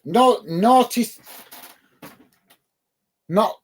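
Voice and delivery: drawn out while squinting at the name; slow struggling read. Real, not acted. Streamer slowly tries to read a viewer's name. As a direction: sound it out slowly, unsure. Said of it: "I can't even read that name".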